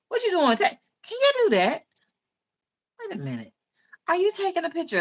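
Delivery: surprised. Real, not acted. Startled by a comment about pictures.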